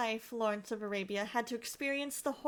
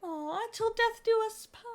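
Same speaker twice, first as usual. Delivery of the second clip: cooing; 10 semitones higher, 5 dB louder than usual. Real, not acted. Cooing at wedding photos.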